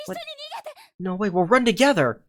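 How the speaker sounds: high, loud refusal; protesting cry. Acted. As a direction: refuse him, alarmed. Heroine protests.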